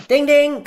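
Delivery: high, loud sing-song ding ding like a quiz bell; bell ding imitation. Host marks a correct answer with a high bell imitation. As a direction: ring it out like a game-show bell.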